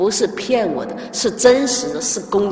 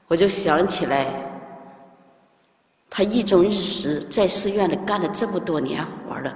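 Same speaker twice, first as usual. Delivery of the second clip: normal talking voice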